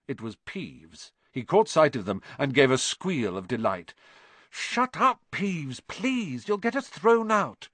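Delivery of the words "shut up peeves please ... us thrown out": urgent plea. A narrator's reading. Children plead with Peeves.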